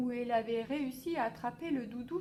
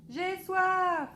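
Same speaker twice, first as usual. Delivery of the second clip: high loud demand; boy shouting his thirst to papa; child yelling; 8 semitones higher, 8 dB louder than usual. A narrator's reading. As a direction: yell out that you're thirsty. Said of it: "hurle-t-il".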